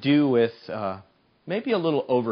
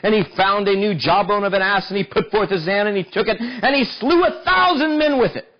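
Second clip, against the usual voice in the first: rising, louder preaching read of the verse; scripture reading; 7 semitones higher, 5 dB louder than usual. Acted. Preacher reads Samson's victory to the congregation, building.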